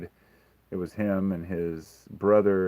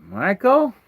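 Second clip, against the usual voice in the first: high, loud questioning call; mock mom call; 13 semitones higher, 8 dB louder than usual. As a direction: call his name up the stairs like his mom. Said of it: "And she goes".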